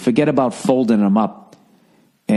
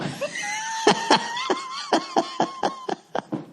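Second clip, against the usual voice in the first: real laugh bursting out, very high and loud; a big shrieking laugh that breaks out; loud burst of laughter; 9 dB quieter than usual. Real, not acted. Laughing out loud at the grocery register. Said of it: "So I laugh".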